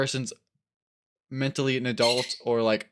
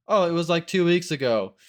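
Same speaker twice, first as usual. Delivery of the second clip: higher, louder feigned realization; mock discovery; 6 semitones higher, 5 dB louder than usual. Checker scrolling the prankster's social media, mock surprised. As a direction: act out finding the damning date, lightly put on. Answering own question as checker.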